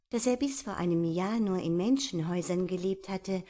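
plain reading voice

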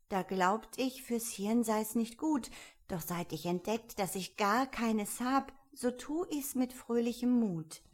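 put-on animated, rhythmic children's poem delivery; poem recitation. A narrator's reading. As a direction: recite the verses as young Alice.

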